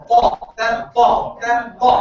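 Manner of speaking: ta said with an echo after it. Shown as a demonstration. Teacher showing the echoing ta to students. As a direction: let each ta ring with an echo. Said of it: "This ta has echo".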